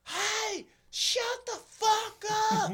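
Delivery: very high, slow hammed-up yell; mock yell. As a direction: shout down from a window, hammed up. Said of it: "somebody yells out".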